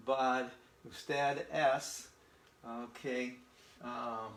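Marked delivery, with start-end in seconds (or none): 0.9-2.1 usted es very slowly, es stretched; slow model phrase. Shown as a demonstration. Teacher repeating usted es slowly for learners. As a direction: lay it out patiently. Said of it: "in English it means you are".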